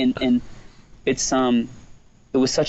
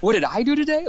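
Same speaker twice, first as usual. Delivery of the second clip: higher, incredulous echo of the question; mock indignation; 12 semitones higher, faster than usual. Stay-at-home dad answering his wife, mock indignation. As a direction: throw the question back, much higher.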